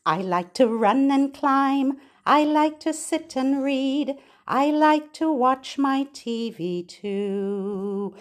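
bright sing-song rhyme for babies; nursery rhyme reading. Acted. Reading a rhyme to babies, sing-song. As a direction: read it lilting, to a little one.

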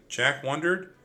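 usual speaking voice

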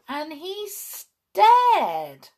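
very slow, with "stared" swooping high; high, drawn-out astonishment. A narrator's reading. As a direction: stretch "stared" high for comic amazement. Repeated with mounting astonishment.

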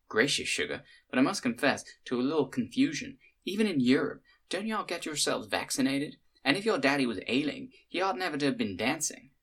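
honeyed Southern hostess, a touch puzzled. Acted. Cynthia's voice, read aloud.

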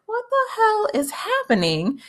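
high-pitched imitated bewilderment; mock shocked reaction. Voices May's shock at seeing Katoa.

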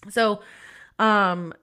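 usual speaking voice

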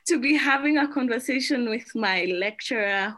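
high, excited greeting breaking into laughter; giddy, thrilled welcome; real excitement. Real, not acted. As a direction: let delight lift your voice high. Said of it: "do you hear an excitement in my voice?".

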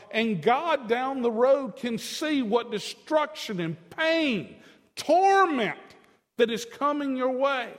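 loud, high, slow preaching build; full-force pulpit preaching; fervent preaching. Real, not acted. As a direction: preach it with rising fire.

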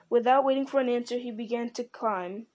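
ordinary delivery, nothing marked

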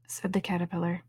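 plain reading voice

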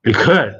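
"record" as ri-CORD, the verb; short "ri", then punch the end of "record"; stress on second syllable. Shown as a demonstration. Presenter teaches the verb reading, second-syllable stress. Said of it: "เน้นคำหลังเป็น".

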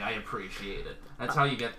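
ordinary talk

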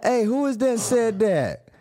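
startled. Retold or imitated. Jailed man calls out, spooked by a voice from the next cell. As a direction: call out, spooked by the unseen voice.

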